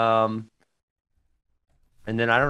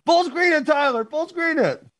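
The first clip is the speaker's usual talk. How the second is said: excited urging. Real, not acted. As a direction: shout it up, can't wait. Urging Tyler, laughing.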